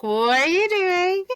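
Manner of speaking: high, slow, stunned "what are you doing"; shocked mock question. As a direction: squeak it out in mock disbelief. Retelling being spanked by students.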